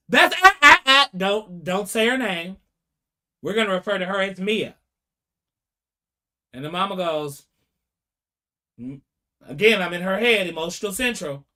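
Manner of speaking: normal talking voice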